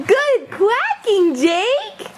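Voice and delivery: high, slow, stretched-out cheering; proud, high-pitched encouragement, drawn out; excited praise. Real, not acted. Praising a child's quacking.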